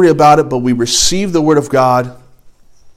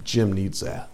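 smug whisper; 5 semitones lower, 14 dB quieter, slower than usual. Retold or imitated.

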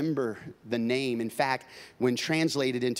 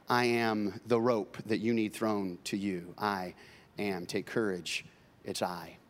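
1 tender litany; 6 semitones lower, 4 dB quieter than usual. Acted. Soft litany of I am.

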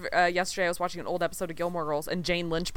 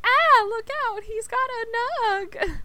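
much higher and louder squealed warning; hammed-up high alarmed cry; imitating the girlfriend; 5 dB louder, slower than usual. Cohost imitating Bulldog's girlfriend's one line, high and loud. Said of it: "and she's like".

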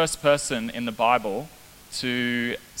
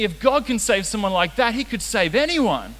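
imitating an amazed teenager, higher pitched; high voice, slowing as it dawns on him; retold awe of a young trainee; wide-eyed realization; 6 semitones higher, 3 dB louder than usual. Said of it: "So big eyes".

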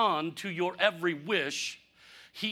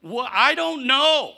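helpless shrug; 5 semitones higher, 9 dB louder, slower than usual. Retold or imitated. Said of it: "And I says".

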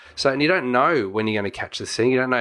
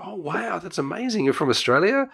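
amazed locals; 7 semitones higher than usual. Retold or imitated. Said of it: "people were like".

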